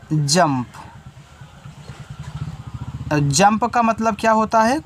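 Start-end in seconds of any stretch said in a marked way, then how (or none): 0.1-0.7 जंप said low and clear as "jump"; teacher modelling the word; model pronunciation. Shown as a demonstration. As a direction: demonstrate जंप for the class. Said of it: "ऐसे".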